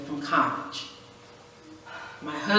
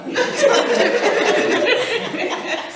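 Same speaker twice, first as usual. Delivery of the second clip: a long high loud laugh; 6 dB louder than usual. Real, not acted. To the ladies about losing the fight, a loud high laugh. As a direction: let out a big ringing laugh.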